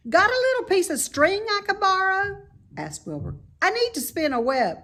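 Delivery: high, loud piggy voice; a young pig, eager and impressed; bright squeaky pig voice; Wilbur the pig. A narrator's reading. Wilbur asking.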